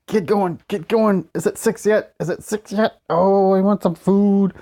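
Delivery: high, pleading hungry voice; impatient hungry whine. Retold or imitated. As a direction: whine at the clock for dinner. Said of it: "at the clock going".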